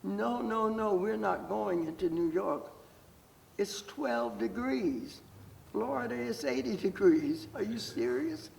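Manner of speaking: imitating a mother's high-pitched, insistent refusal; retold incredulous protest; protesting, near tears. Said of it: "I thought they were gonna cry".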